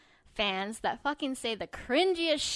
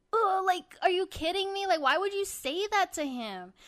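higher-pitched, disgusted 'are you kidding me'; exasperated disbelief; 7 semitones higher than usual. Retold or imitated. Fan retelling her reaction to a cringe fan call, exasperated disbelief. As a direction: groan it out like you can't believe what you heard.

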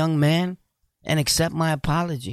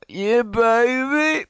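flirty drawl; 8 semitones higher, much slower than usual. Real, not acted. Flirty shout out to Susie J.

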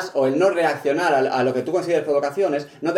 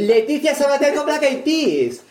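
high, slow showbiz announcement of a dream lineup; excited announcement; 12 semitones higher, slower than usual. Acted. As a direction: announce it like a festival headliner. Fantasía de cartel musical. (anuncio exagerado y lento)

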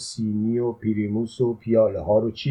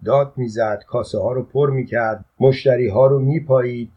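plain reading voice

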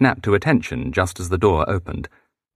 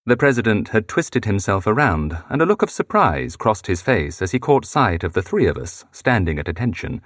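ordinary delivery, nothing marked

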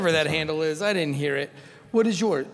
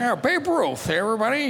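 put-on old-time announcer; 5 semitones higher than usual. Joins the old-timey announcer bit.

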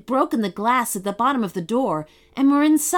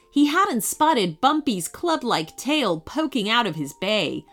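plain reading voice

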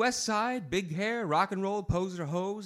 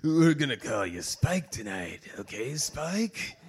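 lower, slow seductive purr; sultry woman; 4 semitones lower, slower than usual. Acted. Bar woman seducing the narrator, slow and low. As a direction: lure him in slowly. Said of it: "The other vagina barked at me".

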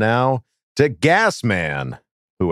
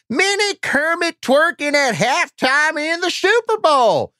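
silly high voice. Acted. Reading fan question in silly voice.

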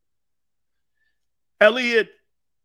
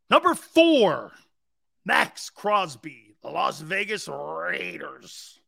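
slow, big announcer read with high, punched numbers; countdown announcer; 3 semitones higher, 3 dB louder, slower than usual. Acted. Host counting down top edge rushers, theatrical announcer delivery. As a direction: announce each name grandly, drawing out the team names.

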